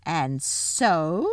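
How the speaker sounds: lightly voiced witch resuming her claim; a hint of a haughty villainess; imperious witch. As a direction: draw it out with menace.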